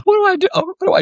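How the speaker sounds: retold panic, pitch leaping high; a new dad suddenly out of his depth, voice shooting up; panicked. Dad told his son may run off tonight, panicked high cry. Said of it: "And I'm going".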